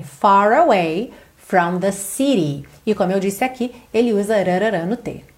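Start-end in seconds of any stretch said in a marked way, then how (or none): 0.0-1.1 slow "Far away" with the R joining both words; linking curled R. Shown as a demonstration. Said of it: "é enrolando o R". ("Far away" ligado pelo R enrolado)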